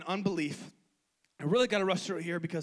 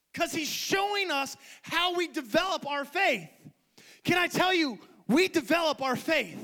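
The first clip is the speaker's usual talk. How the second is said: raised, emphatic preaching pitch; impassioned preaching; 6 semitones higher than usual. Real, not acted. Preacher urging believers to grow their faith, impassioned preaching. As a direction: drive each phrase home to the congregation.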